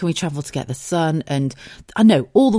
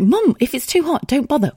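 pleading daughter; 9 semitones higher than usual. Retold or imitated.

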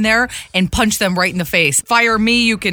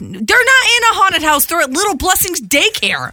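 higher, indignant protest; 7 semitones higher than usual. Real, not acted. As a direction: push back, outraged. Arguing the daycare scare.

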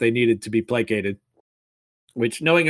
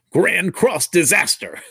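slow, high, louder battle cry; attack name; 6 semitones higher, 4 dB louder, slower than usual. Acted. Knight shouting the attack at the wicked god, dramatic battle cry. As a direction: call out the move like an anime attack.

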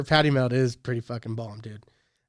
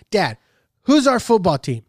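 put-on higher, louder little-girl voice asking dad; imitating his daughter; 6 semitones higher, 5 dB louder than usual. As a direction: pitch up and push it like an eager kid. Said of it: "her big thing is".

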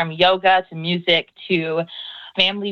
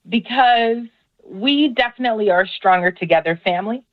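ordinary talk, nothing marked

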